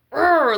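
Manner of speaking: loud, high mock growl of rage; imitated rage growl. Mimicking the boyfriend's rages, a loud high growl. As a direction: let out a short loud "urgh" like his tantrums. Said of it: "like, rages".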